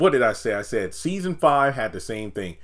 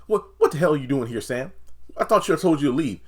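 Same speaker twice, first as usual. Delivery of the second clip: fast, loud yelled imitation; hammed-up yell; mock angry Nancy; 5 semitones higher, 4 dB louder, faster than usual. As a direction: snap at him to get out. Nancy yelling at Sam.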